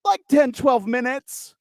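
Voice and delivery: high, slow promoter voice with put-on accent; drawn-out caricature of the promoter; promoter's pronounced accent. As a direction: stretch it out in an exaggerated accent. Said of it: "accent was not that pronounced".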